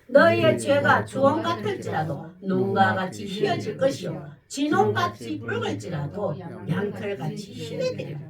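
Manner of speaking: loud, solemn proclaimed reading; scripture reading. Acted. Preacher reading Isaiah to congregation, loud and solemn. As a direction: read the verse out as God's call.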